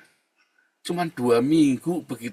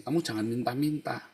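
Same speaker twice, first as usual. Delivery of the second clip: ordinary talk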